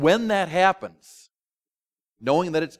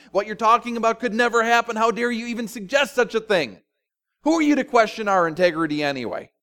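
higher, indignant protest; indignant leaders; 7 semitones higher, 4 dB louder than usual. Acted. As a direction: bristle like offended leaders.

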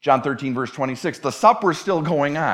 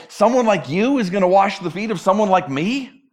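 higher, incredulous question landing on "me"; emphatic disbelief; 4 semitones higher, 3 dB louder than usual. Acted. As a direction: ask it with "you" and "me" set against each other in disbelief. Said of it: "it's even more emphatic".

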